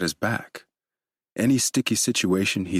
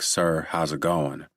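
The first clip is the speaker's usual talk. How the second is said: put-on slow drawl in a Southern US accent; lightly voiced Southern officer, slow; slower than usual. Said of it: "by his deeply southern accent".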